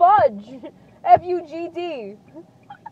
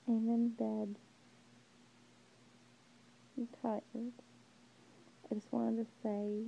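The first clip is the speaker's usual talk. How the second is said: very soft, low bedtime voice; tired, hushed and low; sleepy murmur; 7 semitones lower, 17 dB quieter than usual. Real, not acted. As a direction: murmur it drowsily from the pillow. Sleepy message from bed.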